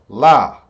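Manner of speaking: "La" said as a separate loud beat; second syllable alone. Shown as a demonstration. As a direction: isolate "La" firmly for learners. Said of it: "Second vowel sound".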